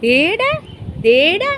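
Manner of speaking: excited calling. Real, not acted.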